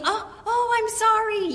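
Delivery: high, loud "oh" of sudden realization; hammed-up flustered apology; startled apology. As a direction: snap out of a daydream, apologizing. Acted startled apology of distracted mother.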